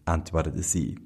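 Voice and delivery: ordinary delivery, nothing marked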